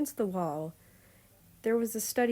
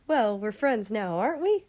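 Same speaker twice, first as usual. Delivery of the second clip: cheerful tease; 3 semitones higher, 7 dB louder than usual. Acted. Beijing teasing Russia cheerfully.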